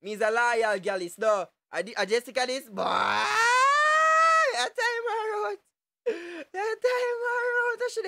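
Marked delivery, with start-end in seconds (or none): 2.8-8.1 high, slow, stretched-out shout; drawn-out yell. Real, not acted. Streamer yelling at a picture on stream, stretched high shout.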